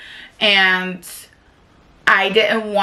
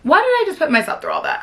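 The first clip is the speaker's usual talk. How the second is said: exasperated disbelief; 11 semitones higher, much faster than usual. Retold or imitated. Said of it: "and I'm like".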